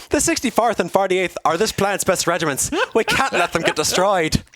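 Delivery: high-pitched character voice with a put-on Irish lilt; Captain Cherry's Irish-style accent. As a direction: play the officer reporting losses in a high, hammed-up Irish accent. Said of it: "I need an Irish music".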